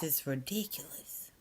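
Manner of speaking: hushed whisper so she stays asleep. Real, not acted. Filming a friend asleep, whispering to viewers. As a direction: keep it breathy and hushed, barely any voice.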